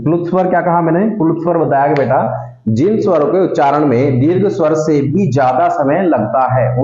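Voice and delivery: normal talking voice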